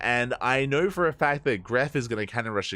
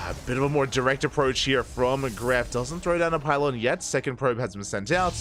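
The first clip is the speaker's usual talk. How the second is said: energetic esports caster; live play-by-play; 4 semitones higher than usual. Acted. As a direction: call the match like a live broadcast. Live casting of the game.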